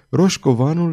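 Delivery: usual speaking voice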